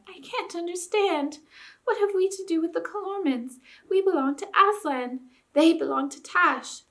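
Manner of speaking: high, innocent questioning lamb. A narrator's reading. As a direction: ask in a young lamb's high voice. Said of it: "the high voice of a woolly lamb".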